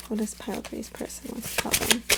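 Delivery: soft, hushed reading question; hushed ritual question. Shown as a demonstration. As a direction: ask the cards quietly. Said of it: "Let's do that again".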